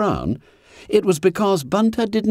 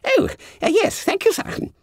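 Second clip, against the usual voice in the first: high squeaky startled stammer; flustered fat schoolboy. A narrator's reading. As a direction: squeal it, caught out. Bunter flustered.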